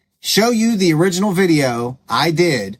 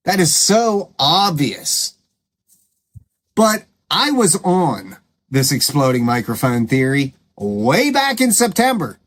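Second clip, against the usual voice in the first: ordinary talk, nothing marked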